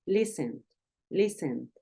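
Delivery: "listened" said quietly, ending in a light D; very soft final D. Shown as a demonstration. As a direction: let "listened" fade on a soft D. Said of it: "mucho más suavecito".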